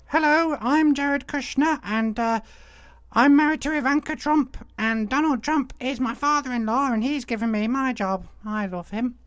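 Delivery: a squeaky, eager little mock voice; imagined Jared Kushner. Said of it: "I was hoping he would be like".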